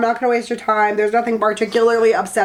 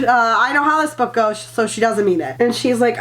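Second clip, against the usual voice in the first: ordinary talk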